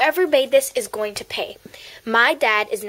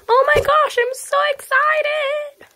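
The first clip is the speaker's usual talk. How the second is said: high, slow squeal of joy; gushing high-pitched delight; excited squeal. Acted. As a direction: squeal it like a thrilled kid. Overjoyed new member squeals.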